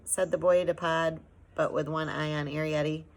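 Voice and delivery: plain reading voice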